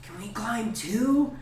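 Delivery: high, drawn-out hopeful question; goblin kids. Acted. Goblin kids asking to climb the bear, hopeful child voice. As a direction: ask slowly like an eager small child. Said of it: "and they say".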